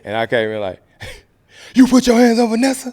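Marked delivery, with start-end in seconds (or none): 1.7-2.9 high, loud, outraged yell; furious accusation. Acted. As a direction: hurl it like a sister defending family. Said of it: "Like, real like that".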